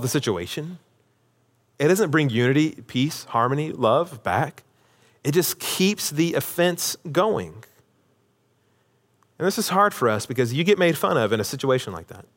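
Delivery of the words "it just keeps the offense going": ordinary talk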